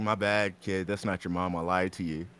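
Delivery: lightly voiced Bowser, low and soft; mild low Bowser confession. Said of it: "Bowser's all like".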